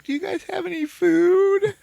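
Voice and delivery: squeaky, giggling ask; mock Talia asking. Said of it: "She's like".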